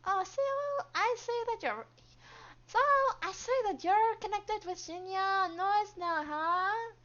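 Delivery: high, loud, put-on voice; grating character voice. Coworker needling about a Noise connection, grating voice. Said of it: "grating voice".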